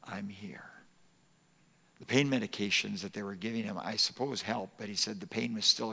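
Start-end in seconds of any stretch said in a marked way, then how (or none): 0.0-0.7 soft tender whisper; whispered comfort. Retold or imitated. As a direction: breathe it to him gently. Repeating the nurse's comfort.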